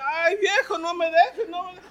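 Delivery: high, loud laughing lament; mock wailing widow. Preacher retelling the graveside story, mock-wailing high through laughter. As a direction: wail it while cracking up. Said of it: "She goes up there".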